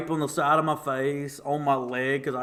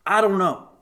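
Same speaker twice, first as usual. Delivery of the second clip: imitating mom; 7 semitones higher, 8 dB louder than usual. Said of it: "Mom goes".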